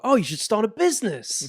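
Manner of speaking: chirpy mock politician advice; Rishi impression. Rishi advising a homeless man, mock chirpy voice. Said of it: "And Rishi's like".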